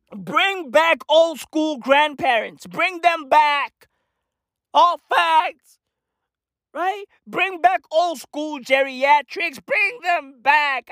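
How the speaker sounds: high, slow, drawn-out demand; emphatic high-pitched appeal; impassioned plea. Acted. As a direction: plead like a protester, stretching the words. Chant-like plea for old grandparents.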